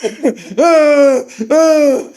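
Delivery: loud, high wailing groans; mock pained moans. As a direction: imitate moaning through pain, half laughing.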